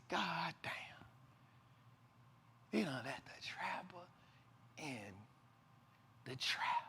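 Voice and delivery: awed whisper; hushed disbelief, breathed out. Real, not acted. Awed at the Stock Exchange.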